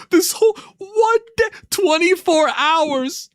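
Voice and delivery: high, drawn-out fake cry; mock wailing. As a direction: wail it like a hurt partner, hammed-up. Streamer mock-wailing a chat complaint.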